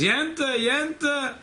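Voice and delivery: high-pitched calling out for his wife; mock scream. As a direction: call out high, imitating an irate husband. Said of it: "he screams".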